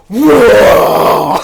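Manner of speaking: loud retold "Whoa!" of a charging lineman; mock shout. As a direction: bellow it as he charges.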